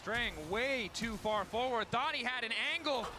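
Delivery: building to a loud, high call; slow climb to a big finish; excited casting. Real, not acted. Caster calls the punish.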